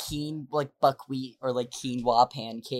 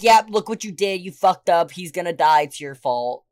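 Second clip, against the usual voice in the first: loud, higher mock accusation; retold harsh blaming; 3 semitones higher, 8 dB louder than usual. Brother blaming his sister over the phone, accusing. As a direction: lay the blame on hard, imitating him.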